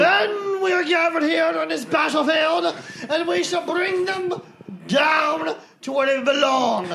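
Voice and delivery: put-on rally the men in a booming British accent. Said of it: "nobody in the Civil War was British".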